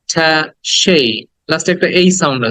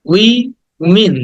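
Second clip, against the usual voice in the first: "Women" drawn out as wee... min; very slow, higher split of "women"; syllable by syllable; 4 semitones higher, much slower than usual. Shown as a demonstration. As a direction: stretch "women" into two separate syllables, wi and min. Teacher breaks the word into syllables.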